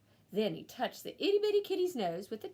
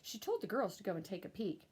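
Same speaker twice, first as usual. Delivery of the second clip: plain reading voice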